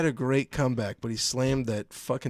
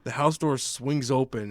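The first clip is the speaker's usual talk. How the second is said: normal talking voice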